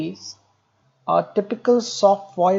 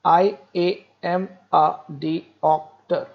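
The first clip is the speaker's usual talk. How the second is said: slow, syllable by syllable; scanning speech; slower than usual. Shown as a demonstration. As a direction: break each syllable apart like a cerebellar patient. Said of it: "a patient comes and talks like me this".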